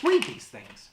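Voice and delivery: "Read" barked high and loud; exasperated shout. Real, not acted. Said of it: "people, read these things".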